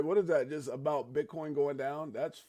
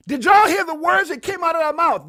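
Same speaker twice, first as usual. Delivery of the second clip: high, much louder disbelieving yell; incredulous shout; 16 dB louder than usual. Real, not acted. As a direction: shout it at the whole chat. Repeats louder in shock.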